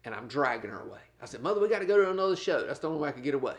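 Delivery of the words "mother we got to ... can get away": fast, eager coaxing; hurried coaxing. Retold or imitated. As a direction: rush her along brightly. Son coaxing mother away fast.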